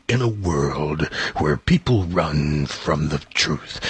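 very low, slow, soft trailer announcer; movie trailer voice. Retold or imitated. Recalling the newsman's incredible voice, slow deep trailer read. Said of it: "It was beyond deep".

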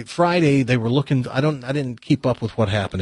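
ordinary talk

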